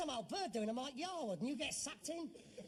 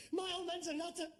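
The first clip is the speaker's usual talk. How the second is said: despairing outcry; 8 semitones higher than usual. Acted. Son declaring his old man senile, wailing despair.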